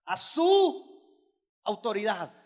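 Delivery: slow shout; preaching shout. Real, not acted. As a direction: bellow it out slowly. Preacher stressing the point.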